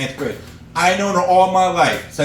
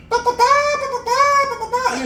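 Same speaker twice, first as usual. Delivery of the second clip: very high bleating ba ba; sheep bleat. Retold or imitated. Comic imitating his farm sheep in a very high bleat.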